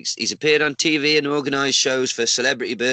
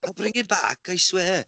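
lightly put-on higher promising voice; mock-earnest promise, pitched up a little; pleading borrower; 5 semitones higher than usual. Snake man promising a friend to return it, pleading borrower.

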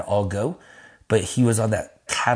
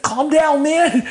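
loud, high shout to calm him; alarmed plea; 6 dB louder, much slower than usual. Retold or imitated. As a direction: shout over a raging friend. Shouting back at yelling friend.

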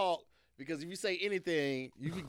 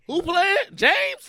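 much higher, louder, rising alarm; dreading who steps in; alarmed mock question; 11 semitones higher, 11 dB louder, much slower than usual. Dreading rough-handed James.

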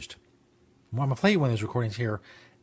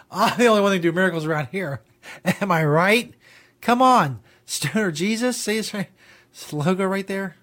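mock boastful shout; 9 semitones higher, 6 dB louder than usual. Mock boast as Stoner Jesus.

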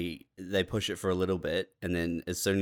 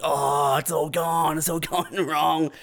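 mock scream; 6 semitones higher, 3 dB louder, slower than usual. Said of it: "just you screaming".

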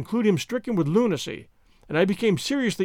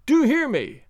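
higher-pitched insistent call; crying out; 6 semitones higher, 3 dB louder, slower than usual. A narrator's reading. Treasure hunter to servant up the tree, insistent cry. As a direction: demand his attention from below. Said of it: "cried he".

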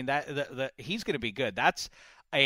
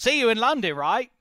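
higher, louder hammed-up English accent; mock English accent; 7 semitones higher, 6 dB louder than usual. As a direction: imitate a guest's put-on English accent. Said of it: "tried to do it in an English accent".

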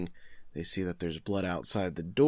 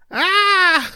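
high, loud sudden scream; retold scream; 11 dB louder than usual. Screaming at the sight in the pond. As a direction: shriek like she's just seen a body. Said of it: "she screams".

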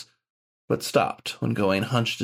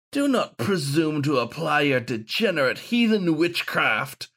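sneering; 7 semitones higher than usual. A narrator's reading. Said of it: "sneered the wolfhound".

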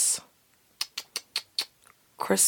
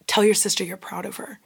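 low-key, softer spoken prompt; inner voice; 3 semitones lower, 6 dB quieter than usual. Retold or imitated. Spirit voice nudging someone during family gratitude circle, quiet. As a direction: murmur it as a voice in your head. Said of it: "I just start hearing this voice".

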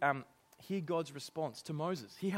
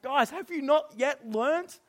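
exasperated; 8 semitones higher, 9 dB louder, slower than usual. Retold or imitated. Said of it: "You're like".

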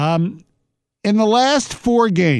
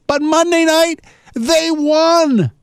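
excited; 11 semitones higher, slower than usual. Real, not acted. Excited reveal of a win.

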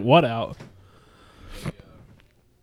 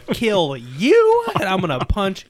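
loud threatening rant; mock threat; 5 semitones higher, 9 dB louder than usual. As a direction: shout the threat. Retold threat, acted quote.

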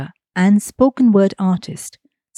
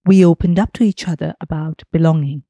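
ordinary talk

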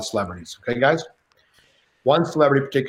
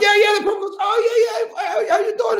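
much higher, louder eager shout; excited shout; 3 dB louder than usual. Retold or imitated. Shane greeting De Niro, much higher and shouting. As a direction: yell it with delight.

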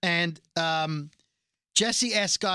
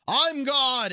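louder, higher acted proclamation; hammed-up divine outburst; mock booming insistence; 7 semitones higher, 5 dB louder than usual. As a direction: proclaim it loudly, imitating an indignant god. Fake God insisting, retold.

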